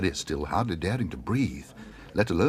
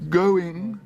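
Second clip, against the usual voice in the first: much higher, louder, pleading call; desperate call; 13 semitones higher, 6 dB louder than usual. Acted. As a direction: beg the room for a higher bid. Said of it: "said the auctioneer desperately".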